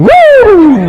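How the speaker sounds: loud, very high, long "woo"; mock elated whoop. Guy skipping to his car after a kiss, mock elated whoop. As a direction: whoop it out, imitating a skipping suitor. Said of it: "and he goes skipping".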